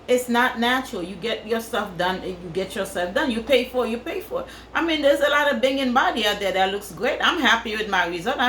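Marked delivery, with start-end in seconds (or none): 3.9-4.5 "pay" pitched much higher, softer, rising at the end; softer, higher second take with a rising lilt; high rising repeat. Shown as a demonstration. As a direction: echo it back high and questioning. Echoing own line with a rise.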